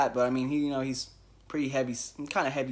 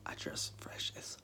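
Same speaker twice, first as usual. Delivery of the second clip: hushed, half-joking admission; sheepish whisper; 6 semitones lower, 18 dB quieter than usual. Retold or imitated. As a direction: drop to an embarrassed whisper. Retelling his own touchy reply.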